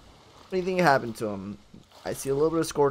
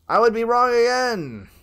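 exasperated call; 7 semitones higher, 6 dB louder than usual. Real, not acted. Player admitting another wrong guess, loud exasperated cry. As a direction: raise it into a loud lament. Same words repeated, more exasperated.

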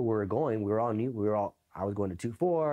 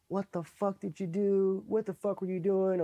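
imitated angry yelling; 9 semitones higher than usual. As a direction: chew out a recruit in a high put-on voice. Instructors confronting him in the story.

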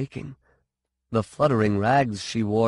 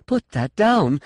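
high, urgent plea; desperate, raised appeal; pleading; 10 semitones higher, 4 dB louder than usual. A narrator's reading. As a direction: plead with rising force. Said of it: "She was pleading".

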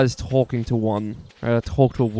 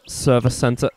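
normal talking voice

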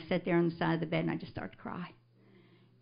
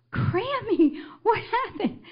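put-on high-pitched, louder child's cry; alarmed grandchild; 12 semitones higher, 4 dB louder, slower than usual. Grandchild to her fallen Grammy, high alarmed cry. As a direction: imitate a startled child calling out.

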